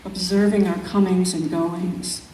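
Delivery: measured, unhurried poetry reading; calm, reflective recital of a nature poem. Acted. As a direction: let each image breathe in its pauses. Reads her poem aloud.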